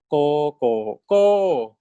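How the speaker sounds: teacher drilling the rising tone; second tone demo. Shown as a demonstration. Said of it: "第二标 gives you 第二声".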